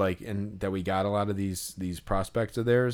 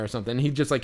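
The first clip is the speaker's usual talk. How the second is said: usual speaking voice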